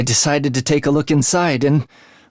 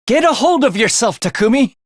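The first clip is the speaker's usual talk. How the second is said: urgent shout; 9 semitones higher than usual. Acted. Ally yelling at Takumi to recover, urgent shout. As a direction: shake him awake with your voice.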